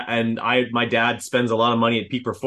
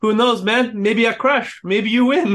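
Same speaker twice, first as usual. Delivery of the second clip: high-pitched imitation French accent; [name]'s French accent; 10 semitones higher than usual. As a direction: put on your best French accent, lifted well above your own voice. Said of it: "my best French accent".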